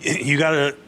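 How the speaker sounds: ordinary delivery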